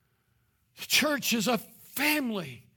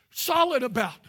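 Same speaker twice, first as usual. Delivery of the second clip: ordinary delivery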